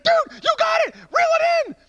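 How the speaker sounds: high, full-voiced yell of encouragement; excited shouting. Buddy coaching him to reel in the bass, shouting.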